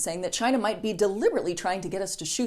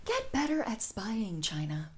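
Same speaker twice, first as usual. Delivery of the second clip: much softer, slow mocking jab; quiet taunt; 9 dB quieter, slower than usual. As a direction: lean in and hush the taunt. Taunting China.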